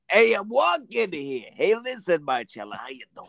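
hammed-up New York tough-talk from the movies; New York movie accent. Co-host asking if New York is like the films, hammed-up New York accent. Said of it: "is it like the films".

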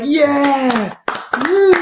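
high celebratory whoop; mock cheering. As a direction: cheer like an applauding group. Group cheers in retold dream.

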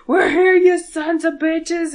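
hollered challenge at demons; mock battle cry. Sam charging into the road, loud high battle cry.

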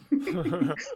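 high, soft chuckle; ironic chuckle. Real, not acted. Storyteller laughing at his doomed plan, amused chuckle. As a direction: laugh softly at the irony.

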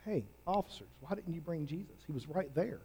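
a quiet, probing demand from the priests; hushed Pharisee challenge. Retold or imitated. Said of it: "and they said".

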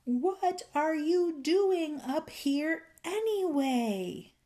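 high, slow, stretched-out holler; bellowed question. A narrator's reading. Fairy right beside the silent old man, bellowing. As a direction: draw out every word as if to someone deaf. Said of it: "he bellowed with all his might".

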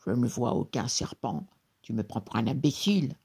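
low, grumbling genie. A narrator's reading. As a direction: grumble it in a put-on monster voice. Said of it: "maugréa-t-il".

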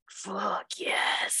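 whispered fuck yes, to herself; gleeful whisper. Retold or imitated. Mom whispering her secret delight at the detective. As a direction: hiss it with private glee. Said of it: "I was like".

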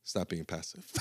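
soft, low, laughing mumble; limp repeat. Retold or imitated. [name] repeating the coach's words, soft and limp. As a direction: say it with no conviction. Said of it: "And he said".

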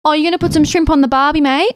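quick, high mock-Australian, American tourist style; American mimicking Aussie. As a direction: put on a hammed-up tourist's Aussie accent. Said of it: "an American come up to me and go".

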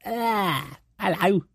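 put-on high, cooing baby-talk hello. Man passing a high chair cooing at a baby. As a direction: coo at the baby like a creepy stranger. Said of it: "you stick your tongue out and kind of go".

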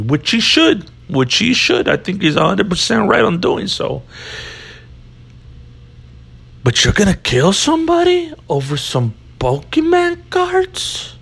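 high, exasperated detective voice; Italian Chicago cop. Pretend. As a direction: scold him like a fed-up cop. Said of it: "worst cop impression ever".

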